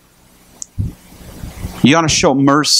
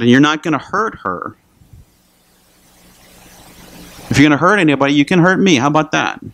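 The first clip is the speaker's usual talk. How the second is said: higher, insistent challenge; retold firm defiance; 4 semitones higher, faster than usual. Shopper stepping between man and lady, firm retold defiance. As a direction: stand your ground, as retold.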